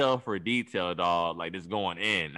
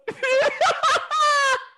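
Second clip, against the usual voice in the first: high, loud burst of laughter; laughing hard at his own story; shrieking laugh; 4 dB louder than usual. Real, not acted.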